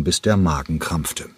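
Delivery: ordinary delivery, nothing marked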